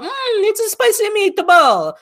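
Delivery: very high, cartoon Italian voice; mock Italian accent. Co-host imitating an actor's Mario audition, mock Italian accent. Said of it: "with that Italian voice of".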